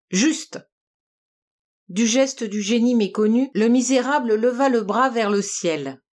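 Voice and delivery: plain reading voice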